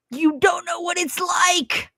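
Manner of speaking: high, slow exasperated cry; exasperated outburst. Retold or imitated. Answering people who say she overreacted, exasperated. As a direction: throw it back at them, fed up. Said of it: "And I'm like".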